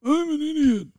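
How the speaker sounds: very high squeaky character voice; comic high-pitched self-mockery; different silly voice. Shown as a demonstration. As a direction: squeal it in a tiny falsetto. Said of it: "Can you say it in a different voice?".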